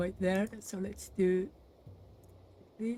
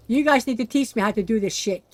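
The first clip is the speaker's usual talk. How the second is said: frustrated loud complaint; 10 dB louder than usual. Real, not acted. Frustrated at failing building.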